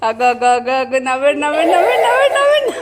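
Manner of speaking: gleeful gotcha, building to a laughing yell; excited calling out. Real, not acted. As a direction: point and crow with laughter. Pointing out laughing child.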